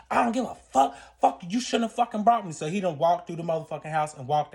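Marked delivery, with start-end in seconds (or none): 0.1-2.5 high, heated retelling of his outburst; acted-out angry cussing; furious refusal. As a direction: snap back as the fed-up boyfriend. Acting out boyfriend's furious refusal.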